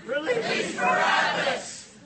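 angry mob yell, high and loud; crowd shouting demands. Pretend. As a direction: scream it like a furious stupid mob. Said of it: "like you're an angry mob of stupid people".

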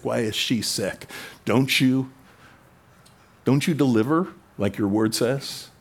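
usual speaking voice

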